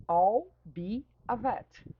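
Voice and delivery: slow, clear classroom model sentence; slow teaching example. Shown as a demonstration. Teacher models a sentence slowly for students, slow and clear. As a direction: spell out each word slowly for the class. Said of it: "Esse I'll be é I will be".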